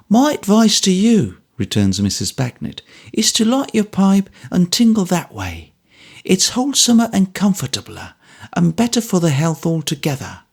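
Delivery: high, brisk motherly advice; Mrs Bagnet, brisk advice. A narrator's reading. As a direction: set him straight kindly.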